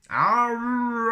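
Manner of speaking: high, loud, strained Wookiee howl twice; Wookiee roar. Shown as a demonstration. Showing a phrasebook's Wookiee word, a loud high howl. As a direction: throw your head back and howl like a Wookiee. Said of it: "Also helpful".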